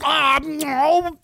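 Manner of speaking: high, wailing plea; mock dismayed viewer. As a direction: plead like a hammed-up disappointed fan. Voices the dismayed viewer pleading.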